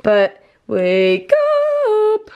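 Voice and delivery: slow, high, stretched call to wake; sing-song it slowly to rouse them; drawn-out wake-up call. Real, not acted. Waking sibling, drawn out call.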